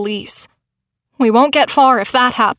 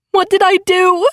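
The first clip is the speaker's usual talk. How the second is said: higher, stress on did; anguished sob; 8 semitones higher than usual. A narrator's reading. Narrator over injured Veronica, anguished sobbing voice. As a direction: break into despair. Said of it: "I burst into an anguished sob".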